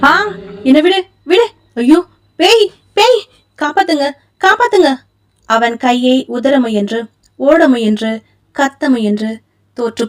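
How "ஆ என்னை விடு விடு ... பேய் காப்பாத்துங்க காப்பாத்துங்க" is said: much higher, screaming for help; terrified screaming. A narrator's reading. Vijay, seized by the ghost, screams terrified for help. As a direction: shriek in panic.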